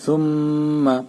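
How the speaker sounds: সুম্মা with a humming nasal ম; ghunna demo. Shown as a demonstration. Teacher giving an example of ghunna. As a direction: let the ম in সুম্মা ring nasally. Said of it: "যেমন সুম্মা".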